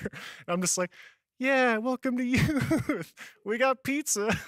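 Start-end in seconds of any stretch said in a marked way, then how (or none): 1.4-4.4 high, slow, sheepish greeting with a laugh; nervous newbie trying to sound upbeat; awkward welcome. Retold or imitated. New youth pastor greeting a crying girl, sheepish.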